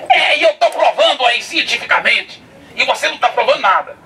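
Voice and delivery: high voice, laughing through the taunt; laughing mockery. Atheist gloating before the students, high laughing taunt. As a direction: gloat at him while laughing.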